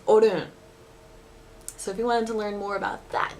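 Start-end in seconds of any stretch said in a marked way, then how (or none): 0.1-0.5 Osaka dialect. Shown as a demonstration. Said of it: "Osaka Ben, it's".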